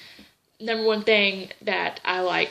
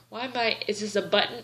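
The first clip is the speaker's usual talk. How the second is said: ordinary talk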